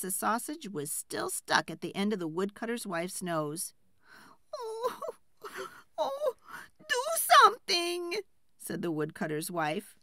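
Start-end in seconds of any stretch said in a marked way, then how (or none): 4.6-8.2 very high, crying wail for help; wailing plea. Acted. Wife with sausage on her nose begging her husband, high crying wail. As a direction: sob and plead in panic.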